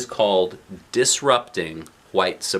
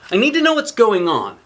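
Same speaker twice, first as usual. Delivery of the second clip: put-on high, louder demanding child voice; insistent little girl; 11 semitones higher, 6 dB louder than usual. A narrator's reading. Girl presses her mom for answers, high insistent child voice. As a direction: make the kid insist on the truth.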